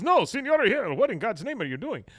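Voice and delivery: high, loud mock alarm; startled protest. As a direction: act out a flustered man caught off guard. Acts startled man caught naked.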